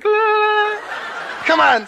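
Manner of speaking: very high, loud squeal; hammed-up ticklish outburst; tickled president. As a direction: squirm and squeal in mock protest. Acts the tickled president protesting.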